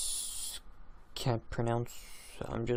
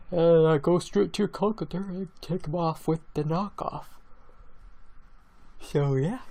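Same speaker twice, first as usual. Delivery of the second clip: talking through a yawn; words stretched by a yawn; 5 semitones higher, 4 dB louder than usual. Real, not acted. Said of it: "sorry about the yawn".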